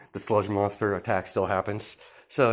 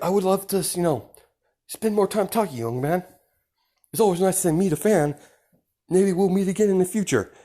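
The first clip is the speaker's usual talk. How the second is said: high, projected superhero voice; a hearty hero's goodbye, pitched up; friendly hero; 10 semitones higher, 5 dB louder than usual. Acted. All Might saying goodbye to a young fan, high and hearty.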